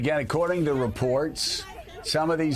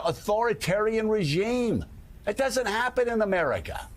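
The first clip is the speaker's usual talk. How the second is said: ordinary talk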